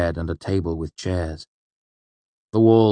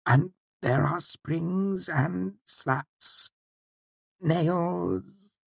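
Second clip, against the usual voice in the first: high, hushed and very slow; ashamed of his own terror; horrified and embarrassed; 11 semitones higher, 4 dB quieter, much slower than usual. Acted. Wynne explains his fear of the bed, horrified and embarrassed. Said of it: "his voice somewhere between horror and embarrassment".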